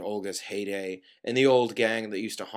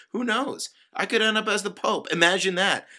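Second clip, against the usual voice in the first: high-pitched sarcastic musing; mock wonder; 9 semitones higher, 4 dB louder than usual. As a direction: toss out the far-fetched idea in a high voice. Sarcastic speculation in acted rant.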